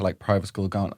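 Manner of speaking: ordinary delivery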